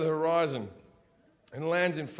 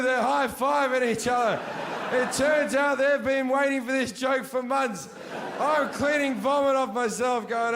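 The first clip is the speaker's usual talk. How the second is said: ordinary talk, nothing marked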